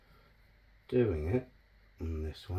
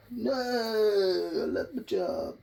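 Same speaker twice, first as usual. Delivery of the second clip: mock crying plea; 9 semitones higher, 4 dB quieter, much slower than usual. Mock pleading after firing.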